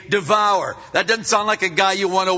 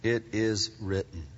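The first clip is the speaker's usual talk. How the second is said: calm firm answer; 9 semitones lower, 9 dB quieter than usual. Retold or imitated. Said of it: "What Jesus do?".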